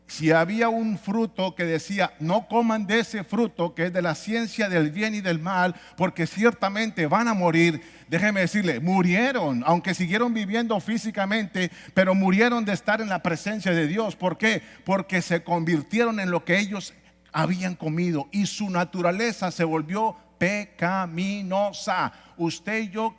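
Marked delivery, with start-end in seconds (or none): 0.1-20.1 loud, emphatic sermon delivery; forceful preaching. Real, not acted. Preacher explains the fall to congregation, forceful preaching. 20.4-22.1 PECAMINOSA slowly broken into separate syllables. Real, not acted. Preacher drums key word into congregation, "pecaminosa" syllable by syllable.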